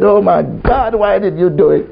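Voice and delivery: mild mock lament. Preacher retelling a complaint to God, mild mock lament. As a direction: plead upward, half in jest.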